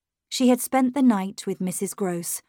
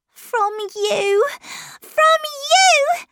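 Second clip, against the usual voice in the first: put-on high-pitched child's shout, building louder; 6 dB louder, much slower than usual. A narrator's reading. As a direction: shout the accusation, rising to a shriek. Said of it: "she shouted".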